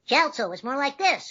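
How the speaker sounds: high bubbly cartoon boy voice; small bubbly squeaky character; bubble voice. Shown as a demonstration. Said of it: "Chaozu was a bubble voice".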